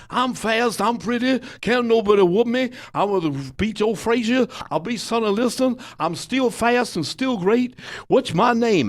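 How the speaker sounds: high, boastful taunting chant; Muhammad Ali. Retold or imitated. Champ showing off in another room, high boastful crowing. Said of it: "And he starts again".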